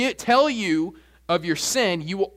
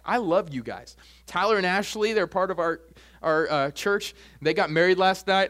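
ordinary talk, nothing marked

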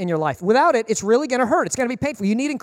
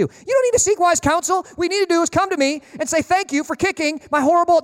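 higher-pitched, insistent scolding; mock rebuke; 7 semitones higher than usual. Preacher correcting a friend who gossips, insistent mock rebuke. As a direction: set them straight, half joking.

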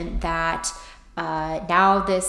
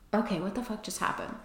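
softer, fast, stunned mutter; bewildered; 10 dB quieter, faster than usual. Retold or imitated. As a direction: say it dazed and baffled. Stunned after the inner voice.

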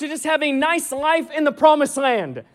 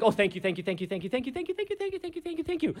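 mock gushing thanks trailing off softer; fading gushing thanks; 13 dB quieter, much faster than usual. Mocking over-apologetic gratitude, growing softer. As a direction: pile on the thank yous till they fade to nothing.

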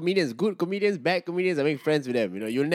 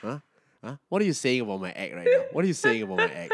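mock paranoid comic; 4 semitones lower, 3 dB quieter than usual. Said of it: "whispers to another person".

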